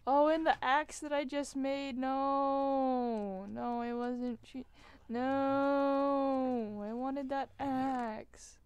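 loud, high, slow lament; anguished wail. Real, not acted. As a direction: moan over the lost axe. Realizing lost new axe.

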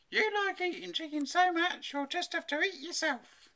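high-pitched mum laying down the law; bossy mother. A narrator's reading. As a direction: lecture the child, high and firm. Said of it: "she said finally".

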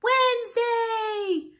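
high, slow drawn-out shout; excited call. Shown as a demonstration. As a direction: call it out with glee. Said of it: "Can everybody say Wednesday?".

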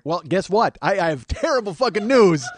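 high, loud mock announcement; comic indignant comeback. Comic answers friend's self-pity, indignant comeback. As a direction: hit him with it, exasperated.

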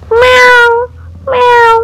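high little meows; cat meows. Pretend. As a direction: mew like a hidden kitten. Cat sound heard.

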